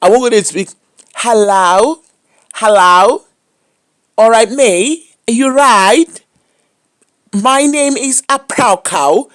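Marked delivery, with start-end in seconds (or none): 1.1-9.3 slow, high put-on posh English accent; mock foreign accent. Comedian mocking a phony accent on the phone.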